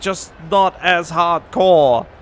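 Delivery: ordinary delivery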